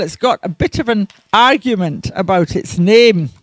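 usual speaking voice

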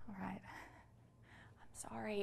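soft, breathy sorry; whispered apology. Acted. Mother apologizes to a nervous newcomer, whispered. Apologizes after the outburst, hushed.

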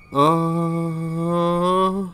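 drawn-out high wail of alarm; long high "uh". Real, not acted. Player unsure what to do, long high wail. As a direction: hold a high, rising "uh". Long cry while playing.

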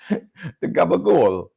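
high, slow, drawn-out fake Italian gabagool; Michael Scott faking a mafia accent, slow and high; mock Italian mafia. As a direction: stretch out gabagool like a wannabe mobster. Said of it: "Michael Scott is trying to pretend like he's in the Italian mafia".

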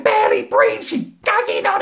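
high, breathless laughing; breathless laughter. Retold or imitated. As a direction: wheeze like helpless laughter. Imitating sister's laughter.

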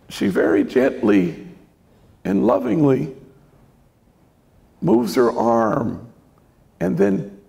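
usual speaking voice

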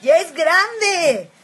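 high, loud party shouts; rally everyone to celebrate; excited party calls, high and loud; excited cheer. Real, not acted. Excited about quinceañera party.

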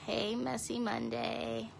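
usual speaking voice

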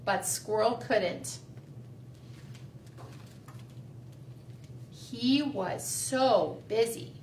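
slow storybook reading; reading to children. A narrator's reading. Teacher reading The Busy Little Squirrel to the class, slow. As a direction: read it slowly to little kids.

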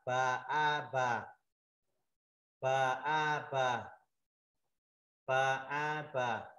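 "pa A pa" slowly, each syllable stretched; syllable-by-syllable drill. Shown as a demonstration. Teacher showing students pa with mark A, slow drill. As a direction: drill "pa A pa" with long ah. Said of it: "mình đọc là".

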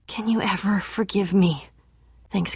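ordinary delivery

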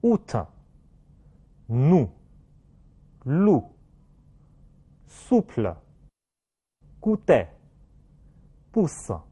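out, nous, loup as separate French words with rounded ou; French word drill. Shown as a demonstration. Teacher has class repeat, nous to pousse with round French ou. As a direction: model souple, goûter, pousse with lips pushed out. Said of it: "在单词里面".

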